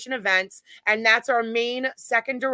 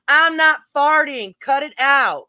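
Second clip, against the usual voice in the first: slow, louder, higher protest; indignant denial; 4 semitones higher, 6 dB louder than usual. Retold or imitated. Imitating David arguing back over farting, indignant denial. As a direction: protest it slowly and loudly, mock-indignant.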